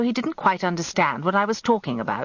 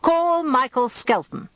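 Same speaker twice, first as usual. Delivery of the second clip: trumpeted summons; 8 semitones higher, slower than usual. A narrator's reading. Barrister summoning his witness, trumpeting call. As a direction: trumpet it out across the court. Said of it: "trumpeted out the summons".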